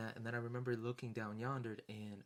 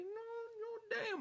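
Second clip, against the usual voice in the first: much higher whiny wail; mock whimper. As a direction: wail it like a kid denied a treat. Begging to see Godsmack.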